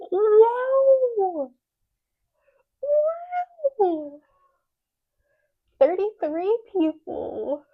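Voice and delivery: loud, high, drawn-out exclamations; real joy spilling over, stretched and high; overwhelmed amazement. Real, not acted. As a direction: wail it out in happy shock. Overwhelmed as visitor count climbs.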